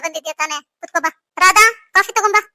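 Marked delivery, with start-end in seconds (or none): 1.4-2.4 higher and louder call across the house. Acted. Mother calling the maid from another room, loud call. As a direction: shout it to the kitchen.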